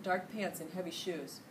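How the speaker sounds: usual speaking voice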